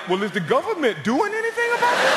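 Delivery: high, earnest alarmed question; naive concern. Retold or imitated. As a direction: ask it in innocent worry. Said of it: "I was like".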